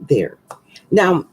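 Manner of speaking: normal talking voice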